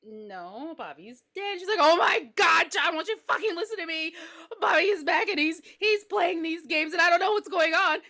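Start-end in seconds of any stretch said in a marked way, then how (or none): 0.0-1.6 soft, subdued husband; calm husband. Acted. Husband answering calmly. 1.8-8.0 high, loud exasperated pleading; worked-up, panicky insistence; frantic mother. Acted.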